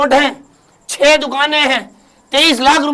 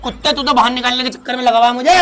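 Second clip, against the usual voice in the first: ordinary talk, nothing marked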